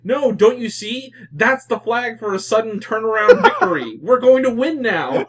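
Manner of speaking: triumphant hero. Retold or imitated. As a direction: announce victory with glee. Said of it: "he's like".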